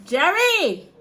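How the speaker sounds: loud, high yell for someone away; calling out. Real, not acted. Mother summoning her son, calling out loudly. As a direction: holler the name across the house.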